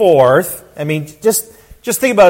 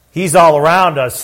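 ordinary talk, nothing marked

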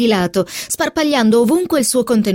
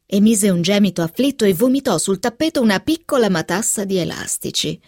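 ordinary delivery

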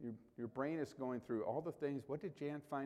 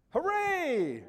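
high, loud mock cheer; a hammed-up cry of joy; mock hooray; 13 semitones higher, 8 dB louder than usual. Pastor mocking a joyful reaction he didn't have, mock cheer. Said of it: "my first initial reaction to that was not".

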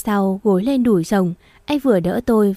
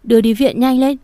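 higher and louder, urging them to hurry; loud urgent order; 4 semitones higher, 5 dB louder than usual. A narrator's reading. Father-in-law orders them to the hospital, loud urgent call. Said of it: "nói lớn".

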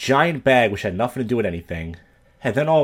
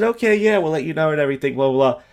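fake polite brush-off; 5 semitones higher than usual. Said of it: "So I said".

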